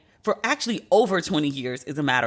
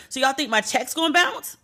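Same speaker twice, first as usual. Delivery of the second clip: livid; 7 semitones higher than usual. Real, not acted. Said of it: "I'm so livid".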